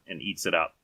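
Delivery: ordinary talk, nothing marked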